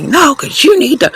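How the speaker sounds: very high mocking yell. Mimicking her ex still yelling on the phone, high mocking voice. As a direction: squeak it up to mock his yelling. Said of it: "he's still yelling at me".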